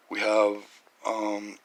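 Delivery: ordinary talk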